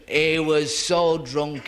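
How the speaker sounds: so drawn out loud and slow; SO stretched out; slow emphatic. Acted. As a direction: lean hard on so. Drunk punchline emphasis.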